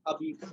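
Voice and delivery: abite said "a-beet", starting on the vowel; silent H. Shown as a demonstration. Teacher shows H is not pronounced, French pronunciation. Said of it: "We pronounce it".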